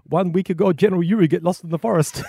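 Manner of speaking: put-on loud, high comic Russian accent; General Yuri. General telling the travel writer a tragic story, loud Russian accent. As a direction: boom the punchline as a Russian general. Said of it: "I'm terrible with accents".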